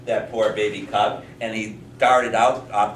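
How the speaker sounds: plain reading voice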